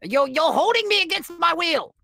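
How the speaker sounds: very high-pitched wail of complaint; indignant protest. Acted. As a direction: protest like a melodramatic captive, pitch soaring. Captive protests in acted quarrel.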